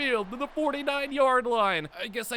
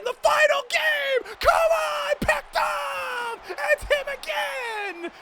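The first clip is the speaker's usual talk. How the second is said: much higher and louder, yelling at the play; screaming with excitement; 5 dB louder, slower than usual. Real, not acted. Player cheering a pick in the championship: ecstatic screaming. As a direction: scream at the screen. Interception in the final game.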